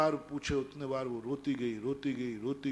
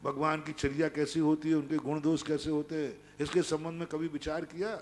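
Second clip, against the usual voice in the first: ordinary delivery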